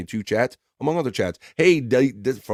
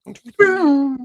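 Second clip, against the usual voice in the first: slow, much higher playful count; high countdown; much slower than usual. Pretend. Host counting down the laser bit, playful. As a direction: count it in like a cartoon. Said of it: "countdown for the pew".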